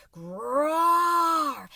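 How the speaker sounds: long loud growling roar; bear roar. Acted. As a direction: roar like an enraged grizzly. Grizzly roars.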